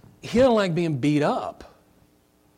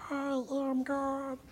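high, soft, slow squeaky voice; tiny chipmunk voice; 11 semitones higher, 9 dB quieter, much slower than usual. Retold or imitated. Mocking Shirley MacLaine's claim, high squeaky chipmunk voice. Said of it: "with her tiny little chipmunk voice".

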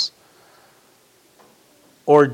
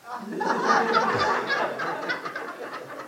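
high unvoiced laugh at himself; breathy laugh; 3 dB quieter than usual. Real, not acted. Pastor laughing at his checkout habit, breathy laugh. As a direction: let the laugh escape.

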